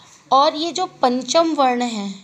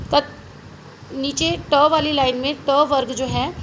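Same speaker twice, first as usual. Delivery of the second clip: ordinary talk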